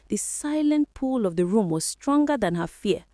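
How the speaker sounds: ordinary delivery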